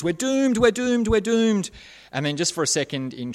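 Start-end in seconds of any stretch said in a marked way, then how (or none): 0.0-1.7 high-pitched mock panic; panicking Homer. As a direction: wail it in comic, drawn-out alarm. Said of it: "Homer's begins to panic and he goes".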